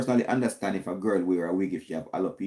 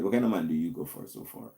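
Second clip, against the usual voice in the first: ordinary talk, nothing marked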